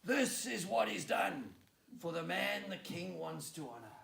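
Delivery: town-crier shout; shouted proclamation. Acted. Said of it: "shout at the top of his voice".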